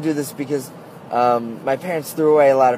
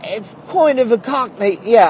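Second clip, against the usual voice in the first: a much higher, bad Cockney accent tried out; Cockney accent attempt; 10 semitones higher, 4 dB louder than usual. Shown as a demonstration. Showing viewers his terrible English accent, a clumsy Cockney. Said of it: "let me see if I can do it".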